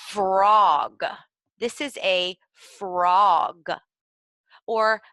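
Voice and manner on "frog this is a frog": loud and slow, every sound of "frog" crisp; name the picture slowly and clearly for small children; clear enunciation. Shown as a demonstration. Teacher showing a picture card to children, loud slow clear naming. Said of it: "you might want to say".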